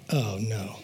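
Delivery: low, quiet sinking dread; deadpan acted dismay; mock dismay. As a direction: drop low and soft, as if doomed. City man dreads his bunkmate.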